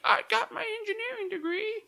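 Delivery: put-on very high, shaky old woman voice; old lady voice. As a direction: squeak it out like a frail grandma. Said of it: "Hopefully, I don't sound like an old lady".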